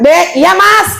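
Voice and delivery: bright loud answer to her husband; loud Padang-style reply. Retold or imitated. Wife answering husband Dek in a loud, high Padang way. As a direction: voice jumps up high on iya Mas. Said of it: "orang Padang biasa aja".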